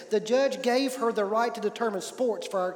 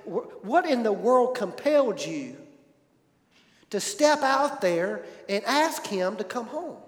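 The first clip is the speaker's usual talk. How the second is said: usual speaking voice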